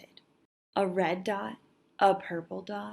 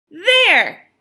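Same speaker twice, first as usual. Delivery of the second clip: a loud, higher "there" like a jab; defiant shout; 8 semitones higher, 11 dB louder than usual. Acted. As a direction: stab the word out in defiance. Girl jabs the paper with marker.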